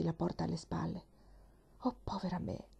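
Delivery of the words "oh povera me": softer, rueful dismay; half to herself, caught out; biting her lip. A narrator's reading. Nancy after slamming the door, soft rueful dismay. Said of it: "esclamò mordendosi le labbra".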